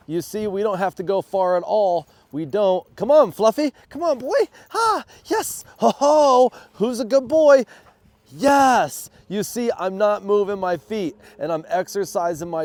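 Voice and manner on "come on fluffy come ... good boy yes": high, loud excited dog praise; talking to a dog. Real, not acted. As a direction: cheer the dog on, pitched way up.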